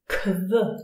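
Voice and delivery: "kv" as a k running into a v; German QU sound. Shown as a demonstration. Teacher showing learners how German Qu is pronounced, sounded out. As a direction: say "kv." as one short k-v cluster. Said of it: "we pronounce it".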